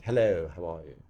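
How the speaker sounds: clipped RP English accent. Shown as a demonstration. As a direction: say it in crisp Received Pronunciation. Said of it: "It's all in the R P accent".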